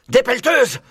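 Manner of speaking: high, loud alarm shout; yelling. A narrator's reading. Maître Renard spotting diggers, alarmed yell. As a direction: yell the danger out. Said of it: "hurla Maître Renard".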